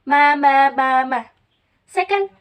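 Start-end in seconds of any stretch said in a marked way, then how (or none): 0.1-1.2 "ma, ma, ma, ma" as loud, separate sustained syllables; loud, held "ma" syllables; tone demonstration. Shown as a demonstration. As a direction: sound out each "ma" loudly as a tone example. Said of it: "Tone is produced because of pitch rhythm".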